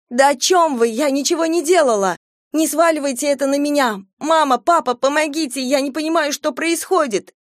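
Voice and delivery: high frantic cries for help; panicked pleading. Acted. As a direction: beg desperately, near screaming. Possessed girl pleads in panic.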